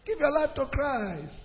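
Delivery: soft, understated gospel appeal, trailing away; mock mild preaching. Shown as a demonstration. Preacher showing how the call will not sound, soft and unforceful. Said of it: "It will not just be".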